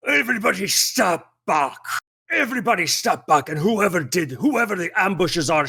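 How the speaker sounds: high-pitched commanding character voice; put-on voice of an armed warrior giving orders; announcing cat man. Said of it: "And he just announces".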